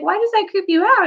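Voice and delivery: put-on high, puzzled kid's question; lightly voiced curious student; curious boy. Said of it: "he's like".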